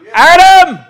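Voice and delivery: high, loud call across the garden; calling out Adam. Acted. God calling for Adam in the garden, loud ringing call. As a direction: call his name out like God seeking him.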